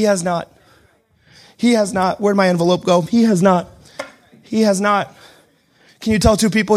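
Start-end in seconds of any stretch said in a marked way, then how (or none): none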